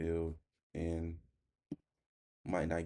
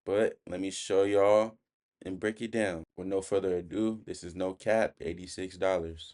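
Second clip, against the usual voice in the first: loud, higher-pitched hook read for the camera; hyped video intro; 3 semitones higher, 9 dB louder than usual. Acted. Trader opening his daily loss video to viewers, loud and hyped. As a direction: punch out the opening like a hype intro.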